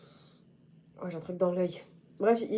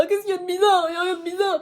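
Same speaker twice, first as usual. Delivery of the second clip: high, loud put-on voice; high defensive put-on voice; mock defensive voice; 12 semitones higher, 6 dB louder, faster than usual. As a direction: squeak it back defensively in a character voice. Repeats question in another voice.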